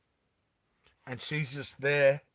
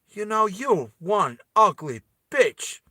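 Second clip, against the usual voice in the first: slow, higher, punched-out words; contemptuous insult; 5 semitones higher, 4 dB louder, slower than usual. Acted. Vas looks at Bella before spitting, slow contempt. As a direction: spit it out word by word.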